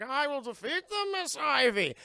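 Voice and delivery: high, loud mock brute; Bane voice. Bane promises Poison Ivy, loud and grand. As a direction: declare it like a dim loyal henchman. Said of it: "It's like".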